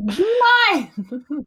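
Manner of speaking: high, loud exclamation; mock call-out. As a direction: belt it out playfully. Acting out her Instagram caption.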